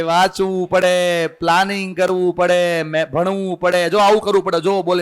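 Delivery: usual speaking voice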